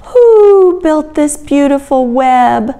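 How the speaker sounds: high, slow hooting question; owl voice. A narrator's reading. Reader voicing the owl admiring the spider's web, high slow owl voice. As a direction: draw it out like an owl. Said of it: "asked the owl".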